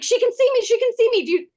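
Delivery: high, loud gushing excitement; thrilled Fae. Retold or imitated. Fae woman on the car roof, gushing high and loud. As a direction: babble like you can't contain the joy. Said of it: "She's so excited".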